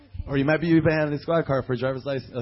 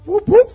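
high, loud imitated police siren; siren whoop; 16 dB louder than usual. Driver tells of being pulled over, imitated siren whoop. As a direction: whoop like a squad car.

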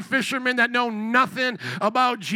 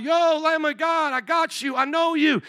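mock shout out; 6 semitones higher than usual. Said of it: "he hears John shout out".

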